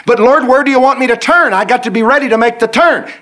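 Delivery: high, loud pleading; pleading questions. Retold or imitated. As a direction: beg God for answers. Believer pesters God.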